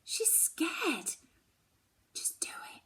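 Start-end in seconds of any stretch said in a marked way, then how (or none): 2.1-2.8 softly whispered, unvoiced. Daughter urging Mum to tell, in a whisper. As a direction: breathe it out barely audible. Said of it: "I whisper".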